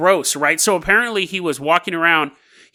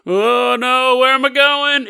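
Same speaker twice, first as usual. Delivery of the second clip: mock woozy panic; 5 semitones higher, slower than usual. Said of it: "does not make you go".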